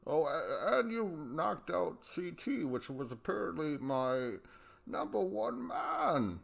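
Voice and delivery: high, slow, haughty mock voice; uppity Rogan voice. Still mocking Rogan as a snob, high and slow. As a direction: stretch it out like a pompous snob. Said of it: "And Jay's like".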